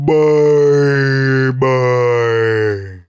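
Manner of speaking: very drawn-out, sliding slowly downward; slow-motion goodbye. Acted. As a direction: stretch it like slowed-down tape. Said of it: "Was that like a slow-mo bye".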